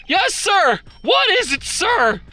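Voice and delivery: hammed-up eager officer; eager subordinate. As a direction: snap to attention eagerly. Voicing eager guard answering.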